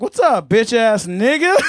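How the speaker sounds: loud slow mock greeting; cocky phone greeting. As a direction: greet callers like a swaggering homie. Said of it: "I'd be like".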